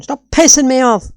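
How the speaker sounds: annoyed. Real, not acted. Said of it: "I'm pissed off".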